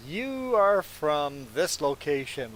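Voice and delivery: slow, deliberate mock declaration; imitated pronouncement. Retelling a lady guessing Jess's home, imitated pronouncement. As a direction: imitate a lady pinpointing someone, drawn out word by word.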